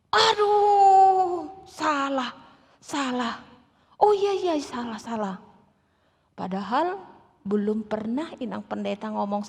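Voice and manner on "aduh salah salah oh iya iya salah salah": flustered lecturer. Retold or imitated. Lecturer admits error.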